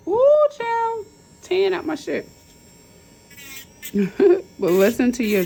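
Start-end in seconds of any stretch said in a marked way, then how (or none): 0.1-2.3 startled exclaim. Real, not acted. Nail tech startled by her own drill slipping, yelping. 3.8-4.5 startled laugh. Real, not acted. Laughs after drill slip.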